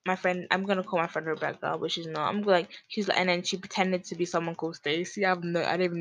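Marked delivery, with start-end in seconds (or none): none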